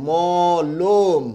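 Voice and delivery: মলম stretched into maw-lom, second vowel a closed o; model pronunciation. Shown as a demonstration. Teacher giving the pronunciation, slow model syllables. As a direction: draw out both syllables of মলম, rounding the second. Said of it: "এটার উচ্চারণটা হবে".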